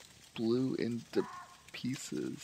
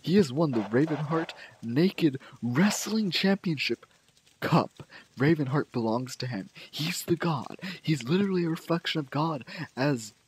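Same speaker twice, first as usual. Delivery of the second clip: higher, loud heraldic announcement; grand proclamation; 3 semitones higher, 4 dB louder than usual. Acted. Player proclaims Baron's new decree crowning Boss Pigman, grandly declaimed.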